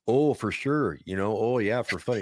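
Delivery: thick small-town Minnesota "oh yeah"; northern Minnesota accent. Shown as a demonstration. Showing the host a hometown accent from Fargo, Minnesota accent. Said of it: "Remember the movie Fargo? That was me".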